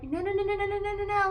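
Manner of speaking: high, rapid-fire string of no's; mock insistent friend cutting in; imitated protest. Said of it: "she was like".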